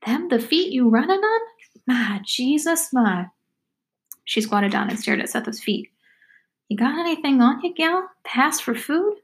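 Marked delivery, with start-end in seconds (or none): 0.0-3.3 high, rising question, then drawn-out exclamation; high-pitched backwoods girl aghast; talkative country girl, marveling. Acted. Girl marvels at swollen feet. 6.7-9.2 high, lilting questions rising at the end; high-pitched backwoods girl wheedling for food; talkative country girl, asking. Acted. Hungry girl asks for food.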